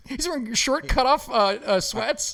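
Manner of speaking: puzzled mock play-by-play; mock announcer. Host describes the strange uniform, mock announcer voice.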